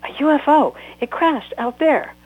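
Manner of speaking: high, loud and breathless news; urgent girl pointing outside; child urging her mother to look; excited child. Acted. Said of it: "Gracie shouted".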